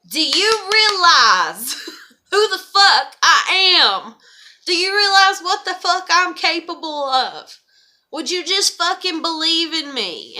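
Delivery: loud, slow, laughing rant at an ex; exasperated outburst. Retold or imitated. Venting to the camera about him, a loud exasperated rant. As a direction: spit out every word, half laughing. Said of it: "I'm just like".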